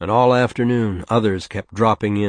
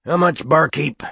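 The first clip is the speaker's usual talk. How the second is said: high rough barfly voice; whiskey voice; 7 semitones higher, 4 dB louder than usual. A narrator's reading. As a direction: ask it like a boozy tramp. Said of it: "the whiskey voice said".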